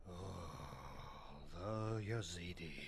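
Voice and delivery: soft, low, drawn-out dawning thought; hushed realization. Acted. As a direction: let the realization sink in quietly. Professor trails into sudden realization.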